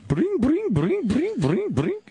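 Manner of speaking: high singsong bring bring; phone ring imitation. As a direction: sing the ringing phone.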